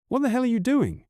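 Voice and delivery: a high, sharp snap; wife snapping. A narrator's reading. Wife catches him packing, snaps in alarm. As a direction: bite the question off hard. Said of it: "she snaps".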